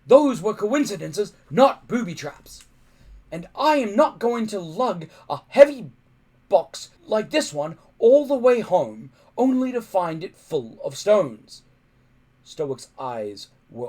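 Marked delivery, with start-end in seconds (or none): 0.1-11.6 loud, high blustering rant; angry dismissal. Acted. Stoic angrily dismissing Hiccup's booby trap fears, loud. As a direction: bluster him into silence.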